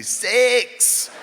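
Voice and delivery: high, exaggerated Scottish "Sex"; Scottish-accented "Sex", punched high; Scottish way of saying sex. Shown as a demonstration. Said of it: "You have to be".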